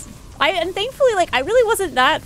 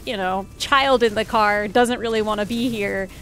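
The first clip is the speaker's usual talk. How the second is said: ordinary talk, nothing marked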